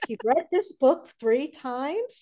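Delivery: high, drawn-out disbelieving question; slow, rising astonishment; incredulous. Retold or imitated. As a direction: echo it back in amazed disbelief. Retold disbelief at fan's claim.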